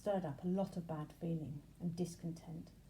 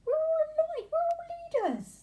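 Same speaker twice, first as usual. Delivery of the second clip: very high, loud chant; shouting; 11 dB louder than usual. A narrator's reading. Bees shouting Benji down, high and loud. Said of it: "they shouted".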